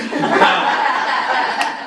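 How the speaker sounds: loud burst of breathy laughter; real laugh. Real, not acted. Trainer laughing at her snake-box example, loud laugh. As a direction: crack up at your own joke.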